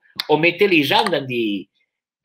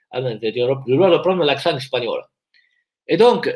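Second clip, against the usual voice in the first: normal talking voice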